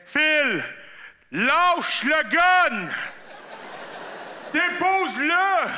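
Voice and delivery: loud, drawn-out command; bellowed warning, words stretched out; urgent shouting. Acted. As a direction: shout orders at a friend holding a gun. Retold buddies shouting at him.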